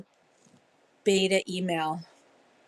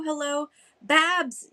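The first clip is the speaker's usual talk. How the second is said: usual speaking voice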